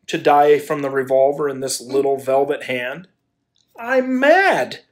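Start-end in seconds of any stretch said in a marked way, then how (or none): 3.8-4.8 high, wild exclamation; frantic cry. Acted. Man crying out that love has made him mad. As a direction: cry it out half out of your mind. Man declares his madness.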